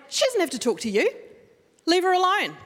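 firm telling off. Retold or imitated. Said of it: "and I went".

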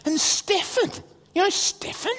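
high-pitched eager questioning; excited child. Retold or imitated. Son asking dad about Stephen, high and eager. Son back with more news.

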